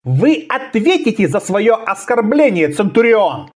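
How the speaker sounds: high, indignant warning; offended threat. A narrator's reading. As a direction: promise payback for the insult. Insulted assassin threatens the officer.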